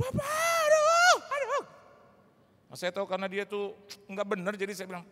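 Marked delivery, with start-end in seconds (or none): 0.0-1.6 very high, loud, slow cry of shock; shocked wife. Retold or imitated. Wife crying out to her husband, overwhelmed by the money. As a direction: gasp it out, overwhelmed.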